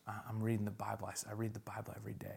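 whispering fast to his son; hushed whisper. Real, not acted. Father showing his son the Bible plan, whispering. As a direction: drop to a quick whisper.

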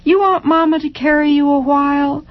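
mother's voice. A narrator's reading. Mother coaxing child in story.

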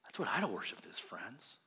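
hushed whisper to the congregation. Real, not acted. Preacher hushing the congregation on idol worship, whispered. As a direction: lean in and whisper it. Solemn sermon turn.

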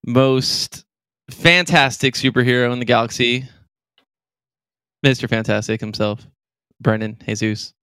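hyped podcast host intro; announcer intro. Acted. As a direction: build up the guest like a ringmaster.

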